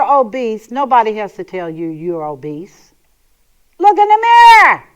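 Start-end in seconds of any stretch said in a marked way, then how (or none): 3.8-4.8 high, loud, fast command; exasperated shout. Acted. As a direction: yell it like an obvious answer. Exasperated command to obese listeners.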